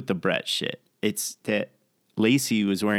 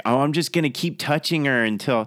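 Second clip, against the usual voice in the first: mock taunting singsong; 5 semitones higher, 5 dB louder than usual. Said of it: "It's like".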